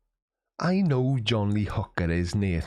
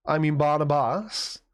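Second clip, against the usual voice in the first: usual speaking voice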